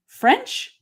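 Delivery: retold query, high rising pitch; questioning. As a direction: offer it up high as a tentative suggestion. She offers a language in story.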